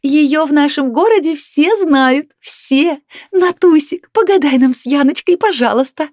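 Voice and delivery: high, loud, insistent gushing; shouting. A narrator's reading. Neighbour begs for fortune-telling before guests, shouting. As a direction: holler a pushy, excited plea. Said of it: "продолжала кричать Люба".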